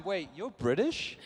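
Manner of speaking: slow, incredulous question; drawn-out disbelief, rising at the end; mock surprised fan. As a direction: imitate a fan caught off guard. Fan startled to learn he's British.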